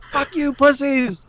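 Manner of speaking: very high, drawn-out mock scream; mock yell. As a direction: imitate yelling abuse mid-crash. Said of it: "I was yelling".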